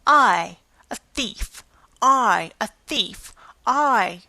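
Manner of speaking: put-on strangled furious old woman, bursting loud; choking rage. A narrator's reading. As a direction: sputter it out in rage. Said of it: "She choked with rage".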